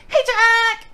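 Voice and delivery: high, loud kawaii streamer greeting; bubbly anime-girl hello; cute anime streamer. Shown as a demonstration. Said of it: "Kawaii shit that I do, which, uh, is definitely".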